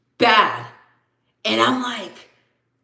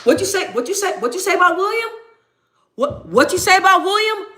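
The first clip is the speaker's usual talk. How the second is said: high-pitched mock confrontation; mock outrage; 11 semitones higher than usual. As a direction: pretend to square up for a friend, voice jumping up high. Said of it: "I'm like".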